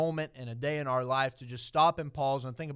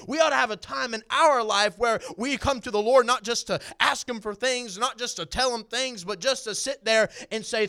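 raised, driving sermon voice; preaching at full force; 6 semitones higher, 7 dB louder than usual. Acted. Preacher to his church, rising in fervour. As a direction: lift the congregation, preach it out.

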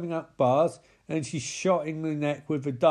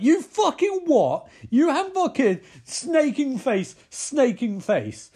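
much higher, louder, slow outraged yell; furious shout; 11 semitones higher, 6 dB louder, slower than usual. Retold or imitated. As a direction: bellow at the kid in outrage. Adult yelling at the kid.

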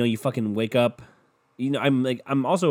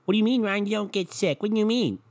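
much higher, whiny mock voice of a sceptic; a high, whiny scoffing voice that needles Ryan; mocking doubter voice; 10 semitones higher than usual. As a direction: play the scoffing friend who doubts him.

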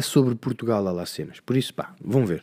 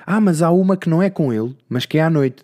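eager friend; 6 semitones higher, 4 dB louder than usual. Retold or imitated. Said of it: "há sempre alguém que vê".

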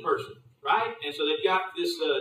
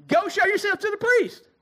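high, ringing command to go; Jesus sending the healed leper off, lifted high; 12 semitones higher, 3 dB louder than usual. Retold or imitated. Jesus calls to lepers, proclaiming high. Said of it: "He replies back".

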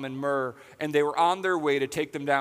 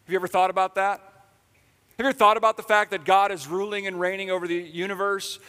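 higher and louder, impassioned; full-force preaching; 6 semitones higher, 6 dB louder than usual. Real, not acted. Pastor preaching to hurting listeners, impassioned. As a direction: drive it home to the room.

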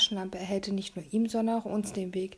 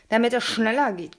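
louder, a gruff snarled answer; growled; 7 dB louder than usual. A narrator's reading. Kidnapper to the nosy boy, gruff growl. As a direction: snap it back at the kid like a growl. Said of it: "knurrte er".

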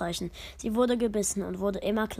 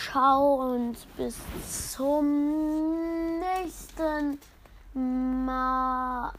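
very slow, stretched sign-off; drawn-out goodbye; 3 semitones higher, much slower than usual. Real, not acted. The narrator bids viewers goodbye, stretching the words very slowly. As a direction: stretch each word out long.

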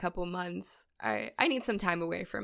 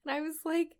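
normal talking voice

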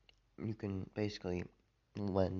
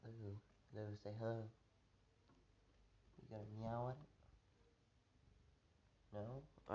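soft talk to cat; 12 dB quieter than usual. Real, not acted. Coaxing his cat to meow.

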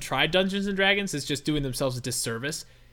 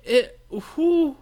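higher-pitched baffled mock questions; confused; 7 semitones higher, much slower than usual. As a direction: act out someone zoning back in, lost. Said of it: "I was like".

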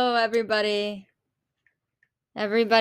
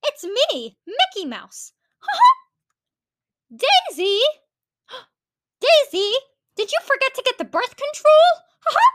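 much higher squeaky cartoon mouse; Mickey Mouse impression; 14 semitones higher than usual. Vlogger doing a cartoon mouse for viewers, high squeak. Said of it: "that's my Mickey Mouse impression".

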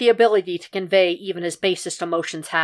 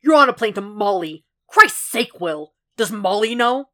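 exasperated outburst, high and loud; snarling; 4 semitones higher, 5 dB louder than usual. A narrator's reading. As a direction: order him off, voice leaping in anger. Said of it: "Jack snarled".

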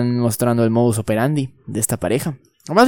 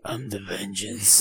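Batman voice; 3 semitones lower, 10 dB quieter, much slower than usual. Retold or imitated. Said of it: "Un acento Batman".

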